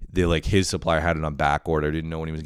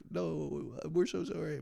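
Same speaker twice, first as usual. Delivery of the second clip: high, soft imitation of apologetic parents; mock parental apology, high and hushed; 11 semitones higher, 11 dB quieter, slower than usual. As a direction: plead softly up in a high voice. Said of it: "my parents would be like".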